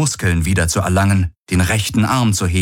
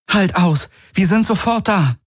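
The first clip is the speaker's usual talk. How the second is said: much higher urgent call; 13 semitones higher than usual. A narrator's reading. Kirai tells Hawks to hold on, urgent call. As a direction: shout reassurance across the cave. Calling to wounded comrade.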